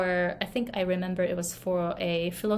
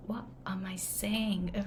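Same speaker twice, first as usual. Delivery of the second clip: a hushed, baffled aside; whispered thought; 7 dB quieter than usual. Retold or imitated. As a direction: whisper it to yourself, puzzled. Confused inner thought retold.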